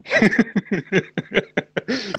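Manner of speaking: long high giggling laugh; sustained chuckling laughter; real amused laugh. Real, not acted. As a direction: crack up at the joke. Laughs at Birmingham joke.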